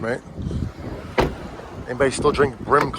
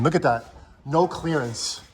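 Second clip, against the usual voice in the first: ordinary delivery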